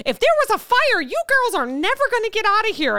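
high, alarmed busybody voice; hammed-up high scolding warning; complaining shopper. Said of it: "a woman walked up to the bakery".

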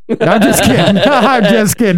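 chuckling through the words; laughing it off. Real, not acted. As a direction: laugh as you take the joke back. Laughing off his own joke.

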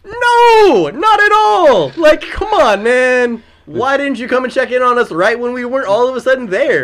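high, full-volume wail of protest; retold outraged yelling. Storyteller yelling at the friend who never checked on them, outraged. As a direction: scream the refusal, then rant on loudly. Acted outburst at Ben.

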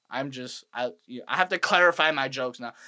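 usual speaking voice

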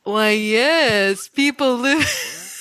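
drawn-out, badly faked West Virginia man; botched local accent. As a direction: stretch out a put-on West Virginia voice. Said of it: "That sounds like s-, not what I wanted".

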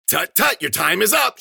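high, loud singsong yell. Acted. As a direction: yell it like a gleeful attack cry. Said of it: "she yelled".

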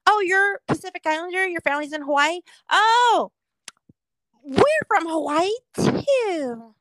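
high, loud gushing mock voice; mocking clueless tourist. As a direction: gush like an eager outsider. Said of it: "people are like".

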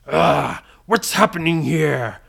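startled pirate captain, lightly played; a hint of a villainous pirate; lightly voiced pirate villain. Storyteller as Blackbeard facing the whirlwind, lightly voiced pirate villain.

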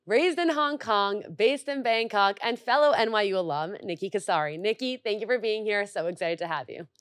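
bright announcer-style welcome; podcast intro read. Acted. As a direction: open the show like a radio host. Host intro and guest introduction.

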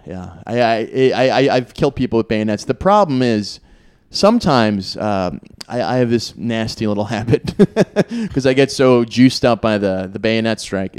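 usual speaking voice

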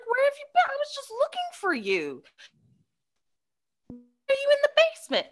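put-on high, fast flustered babysitter voice; alarmed babysitter. Babysitter finding the girl at the basement door, alarmed high voice.

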